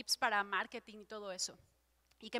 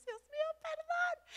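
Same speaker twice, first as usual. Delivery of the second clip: much higher, pleading cry for forgiveness; mock panicked plea; slower than usual. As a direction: squeak it out like a scolded child. Panicked plea after God's rebuke.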